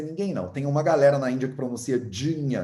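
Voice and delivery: ordinary delivery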